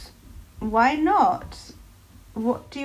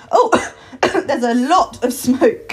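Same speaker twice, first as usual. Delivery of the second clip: coughing through words; 5 dB louder, slower than usual. Acted. Smoke pouring from jar.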